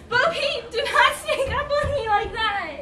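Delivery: high, loud, laughing protest; startled laughing scold. Acted. Red startled by Bo Peep sneaking up, high laughing protest. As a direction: jumps and laughs it off.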